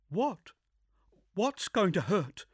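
high, anxious questions; confused and scared; alarmed young woman. Acted.

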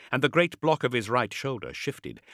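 ordinary delivery

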